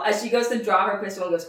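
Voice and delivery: ordinary talk, nothing marked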